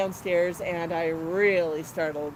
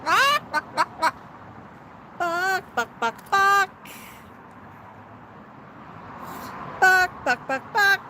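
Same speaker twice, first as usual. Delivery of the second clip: coaxing clucks; 7 semitones higher than usual. Pretend. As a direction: cluck like a hen to get an answer. Coaxing the bird to cluck back.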